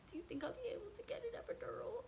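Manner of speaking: high, breathy, quick little plea; pleading whisper. Retold or imitated. Asking the nurse for an epidural, in a pleading whisper. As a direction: beg hopefully under your breath.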